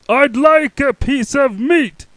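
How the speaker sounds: loud, slow high boom. Acted. Mister Noisy ordering meat, booming. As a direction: boom it slowly. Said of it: "Mister Noisy boomed".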